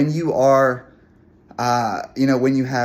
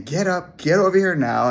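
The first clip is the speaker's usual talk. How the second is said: higher, insistent mock scolding of the dog; angry command; 6 semitones higher than usual. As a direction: bark the order, higher pitched and firm. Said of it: "spanking him and saying".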